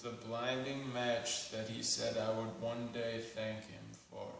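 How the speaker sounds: measured spoken-word poem with long pauses; deliberate poetry reading, lines left to hang; poetry recitation. Acted. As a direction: recite it solemnly, phrase by phrase.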